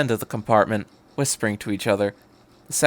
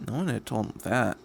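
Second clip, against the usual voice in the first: ordinary delivery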